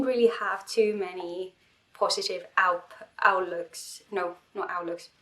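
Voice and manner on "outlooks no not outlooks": usual speaking voice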